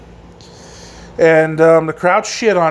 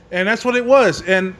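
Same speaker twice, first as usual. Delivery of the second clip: normal talking voice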